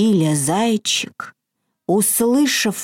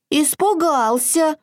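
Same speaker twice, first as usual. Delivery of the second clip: high, small hare voice; timid hare admitting; 8 semitones higher than usual. A narrator's reading. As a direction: confess it like a shy bunny. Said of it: "признался зайчик Филя".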